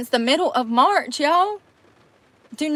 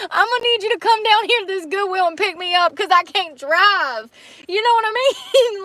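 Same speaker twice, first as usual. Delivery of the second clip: laughing; 9 semitones higher than usual. Pretend. Imagined call from the Goodwill.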